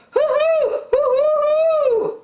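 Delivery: high, loud indignant hooting; hammed-up angry owl hoots. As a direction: hoot crossly at the intruder. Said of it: "said the owl angrily".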